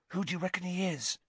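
soft, quick, high hiss; hurried secretive whisper. A narrator's reading. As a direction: hiss the question fast under your breath. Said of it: "Ron hissed".

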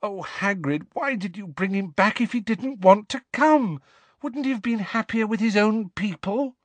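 high-pitched despairing questions; dismayed, pleading reproach; distraught pleading. A narrator's reading. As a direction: implore him, voice pitched high. Distraught Hermione pleading with Hagrid.

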